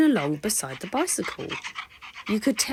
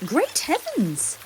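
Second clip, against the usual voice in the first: surprised; 9 semitones higher, slower than usual. Acted. Surprised exclamation in rain.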